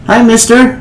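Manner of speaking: put-on high little boy greeting; child voice. GM as young boy greeting the traveler, high child voice. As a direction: pitch up like a shy nine-year-old.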